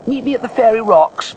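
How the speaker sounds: high, loud and lively character voice; Morwenna's story voice. Acted. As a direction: call out a meeting place to a friend.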